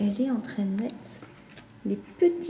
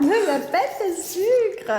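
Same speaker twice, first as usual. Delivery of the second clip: slow, high laughing reply; 9 semitones higher, 4 dB louder, much slower than usual. Real, not acted. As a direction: laugh through the words. Mother laughing at child.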